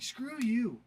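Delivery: hammed-up retold outrage; mock angry snap. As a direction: snap it back like the offended brother. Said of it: "he was so mad".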